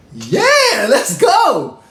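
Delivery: high, loud excited burst with a laugh; hyped yes. Retold or imitated. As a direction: shriek it like you just won tickets. Hyped friends accept floor tickets.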